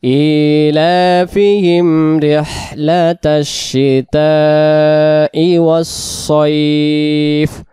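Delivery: slow drawn-out tajweed recitation; measured Quranic chant. Shown as a demonstration. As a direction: recite each word slowly and carefully. Recitation of the verse.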